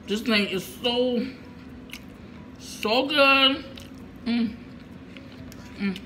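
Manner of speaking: talking while chewing; mouth full. Real, not acted. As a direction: between bites of food. Seen 2: forkfuls of coleslaw then rice to mouth; chewing between bites.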